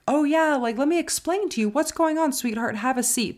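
high, sugary imitation of kindness; sarcastically nice host voice; mock welcoming. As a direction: put on an overly sweet, accommodating voice. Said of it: "They're not the most welcoming of people. They're not just like".

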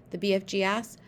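usual speaking voice

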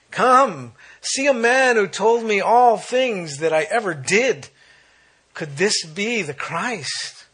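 high, loud, drawn-out proclamation; urgent summons, pitched high and stretched out; excited calling out. Acted. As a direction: call the townspeople over, slowly and loudly. Acting out woman calling the townsmen.

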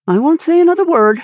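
high, loud, quick promise; emphatic promise. A narrator's reading. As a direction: blurt out an emphatic vow. Harrison promises to stay silent.

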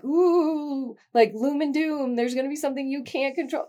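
high-pitched, spooky-teasing "ooh"; mock doomsaying. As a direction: parody a prophet of doom. Parodies herself as a doomsayer.

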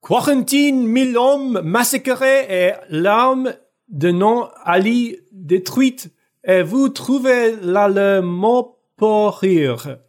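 slow, high, laboured French reading. A narrator's reading. Annoyed Andrew switches to French, slow theatrical French. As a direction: declaim the French word by word. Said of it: "he starts speaking in French".

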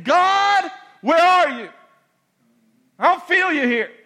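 shouted plea to God, high and loud; desperate high shout at heaven; anguished cry. Retold or imitated. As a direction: cry out to a God who feels absent.

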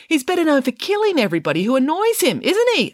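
high-pitched pointed scolding; indignant leading question; accusing. A narrator's reading. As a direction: press the accusation, climbing at the end. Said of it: "accused Judith Piggins".

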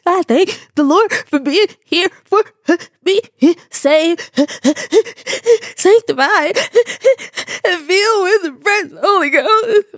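mock sobbing, high, gasping between single words; hammed-up child testifying through hiccupy sobs; little kid crying. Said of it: "when you little crying".

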